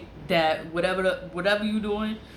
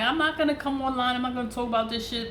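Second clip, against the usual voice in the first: higher-pitched, hurried protest to oneself; insistent self-quote; 6 semitones higher, faster than usual. Retold or imitated. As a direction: recount your own firm refusal, pitched up. Quoting own resolve in frustration.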